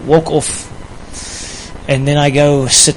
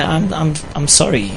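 softer, hesitant sheepish apology; quiet apology; 8 dB quieter than usual. Retold or imitated. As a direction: mumble a sheepish sorry to his wife. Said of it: "I'll say".

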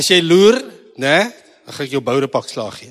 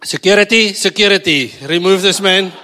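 loud, higher mock alarm; mock call for security; 3 semitones higher, 8 dB louder than usual. Preacher jokingly summons security to remove a man, mock urgent shout. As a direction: call out loudly for the guards, as a joke.

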